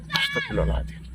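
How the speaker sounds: very soft, breath-like murmured prayer; barely audible pious muttering; hushed self-calming. Retold or imitated. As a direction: murmur it under your breath to calm yourself. Acting his self-restraint when angry.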